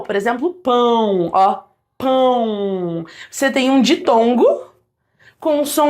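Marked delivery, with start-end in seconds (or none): 0.5-3.1 PÃO drawn out with a strong nasal glide; nasal diphthong. Shown as a demonstration. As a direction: stretch PÃO slowly, humming it through the nose.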